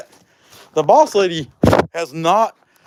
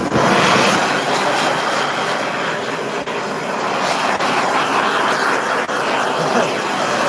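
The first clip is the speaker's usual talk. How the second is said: long wheezy real laugh; 6 semitones lower than usual. Real, not acted. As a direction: break up laughing at your own gloom. Laughs at own tax rant.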